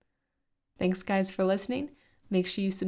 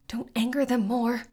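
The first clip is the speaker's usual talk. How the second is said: urgent rasp; 5 semitones higher, 3 dB louder than usual. Acted. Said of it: "Shally rasped in Talay's ear".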